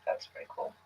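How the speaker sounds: very quiet, dry retold remark; low-key, possibly sarcastic aside; sarcastic-sounding. Woman retelling her reaction to his kids, sarcastic-sounding. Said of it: "That sounded like a sarcastic comment".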